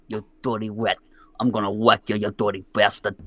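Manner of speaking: low New York mafia-style threat; Tony Cherubini. Retold or imitated. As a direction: drop low like a Brooklyn wise guy. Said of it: "he used to speak like this".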